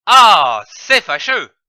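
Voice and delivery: a loud, vexed Ah that settles into mild annoyance; a small grumble of annoyance; annoyed ah. Shown as a demonstration. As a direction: sigh out the bother of it. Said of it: "pour donner un sentiment".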